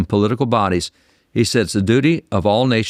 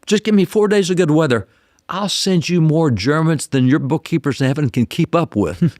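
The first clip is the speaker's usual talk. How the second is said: retold cussing-out; 7 semitones higher than usual. Soldier bargaining with Jesus for good weather, retold cussing-out. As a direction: act out an exasperated bargain, half amused. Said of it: "he cussed out Jesus real good".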